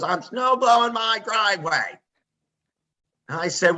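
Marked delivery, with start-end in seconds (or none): 0.0-2.0 higher put-on old man's voice; lightly voiced old grandfather; grandpa's voice. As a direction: give him a stubborn old man's answer. Grandpa answers, voiced in story.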